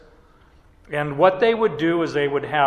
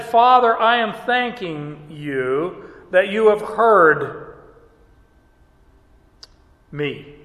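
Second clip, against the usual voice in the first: Jesus praying; 2 semitones higher than usual. Acted. Jesus's prayer voiced in a sermon.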